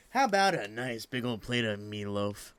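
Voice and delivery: low, hammed-up folksy offer; folksy put-on voice. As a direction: drop the pitch and savor it like a corny diner pitch. Voicing hungry park visitor's thought.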